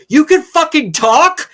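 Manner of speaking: high, loud astonished yell; shocked shout. Retold or imitated. Teammate stunned that the shark can talk, shrieking in shock. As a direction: blurt it out in total disbelief. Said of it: "He's like".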